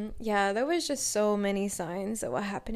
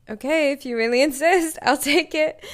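laughing mock reluctance; 4 semitones higher, 9 dB louder than usual. Mock reluctance accepting gifted ticket.

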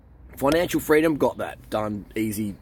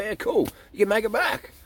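carefree shrug; 10 semitones higher than usual. Retold or imitated. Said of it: "and it's like".

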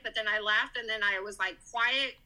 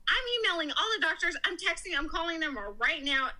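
high, fast rush of words; imitating excited supervisor; 6 semitones higher, faster than usual. Head supervisor spreads the news to doctors, excited. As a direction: reel off the calls in a hurry.

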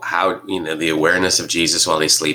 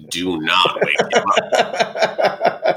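laughing mock warning; 12 semitones higher, much slower than usual. Joking warning about napping Jesus.

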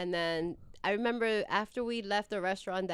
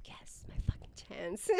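whispered, soft secret triumph; gleeful whisper; 8 dB quieter than usual. Retold or imitated. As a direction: whisper it like a guilty win. Secret glee at his breakup.

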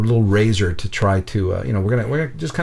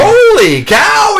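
very high, drawn-out yell; mock shocked shout; 8 dB louder, much slower than usual. Storyteller capping the nurse's discovery, hammed-up astonished shout. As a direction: holler it in comic astonishment.

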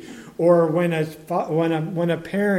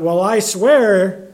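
mock insistent swearing; 5 semitones higher, 7 dB louder, slower than usual. Mocking someone who adds oaths.

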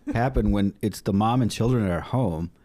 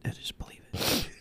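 hushed conspiratorial whisper; 9 dB quieter than usual. Pretend. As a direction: breathe it out, no voice at all. Whispered pretend family chatter.

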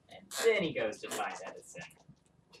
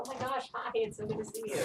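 excited; 8 semitones higher, 5 dB quieter than usual. Acted. Character greets long lost friend.

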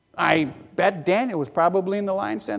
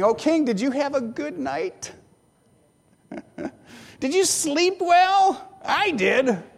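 cheeky greeting; 5 semitones higher than usual. Acted. Daniel teasing the sleepless king from the den, playful. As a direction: tease the king who slept badly.

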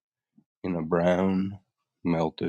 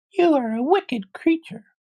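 put-on very high, louder accusing girl voice; angry little girl; 5 dB louder than usual. Dorothy accuses the witch of wickedness, angry. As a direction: scold in an indignant, squeaky child's voice. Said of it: "grew angry".

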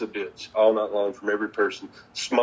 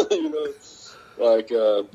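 ordinary delivery

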